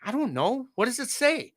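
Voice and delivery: a hint of mom pretending not to know; mom playing dumb. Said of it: "and she's like".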